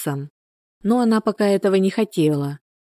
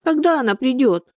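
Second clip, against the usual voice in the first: put-on high, plaintive child's question; acted high-pitched child's whimper; whimpering little girl; 8 semitones higher than usual. A narrator's reading. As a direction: play a tearful small girl asking. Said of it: "всхлипнула Дашутка".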